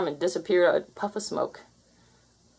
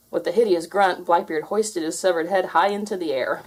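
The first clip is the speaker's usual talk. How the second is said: ordinary delivery, nothing marked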